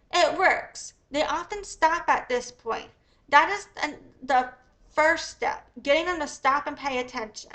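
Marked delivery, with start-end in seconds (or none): none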